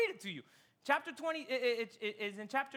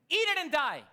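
grim exclaim; 7 semitones higher, 7 dB louder than usual. Acted. Repeating widow's grim words.

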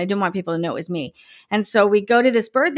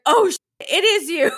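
mock surprise; 9 semitones higher than usual. Mom realizes, surprised.

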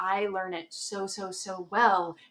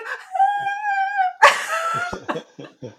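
very high, loud squealing laugh; shrieking laughter; 11 dB louder than usual. Real, not acted. Remembering her fangirl moment, shrieking laughter. Recalling crying at meeting.

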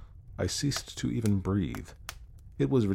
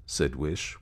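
usual speaking voice

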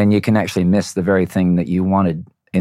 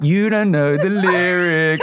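slow, teasing little voice in his head; taunting inner voice; 9 semitones higher, much slower than usual. Retold or imitated. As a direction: drag it out like a nagging inner voice. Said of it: "this voice keeps going".

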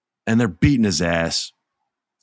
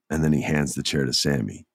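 normal talking voice

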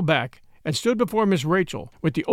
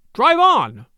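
cried out louder and higher; loud cry; 7 semitones higher, 7 dB louder than usual. Acted. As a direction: shout the order over everyone. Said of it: "cried Miss Rachel, louder than ever".

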